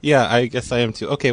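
ordinary talk, nothing marked

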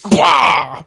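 high punchy battle shout; mock battle cry. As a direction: bark it like a Hulk smash. Retold smashing the centipede.

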